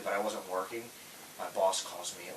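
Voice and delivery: ordinary delivery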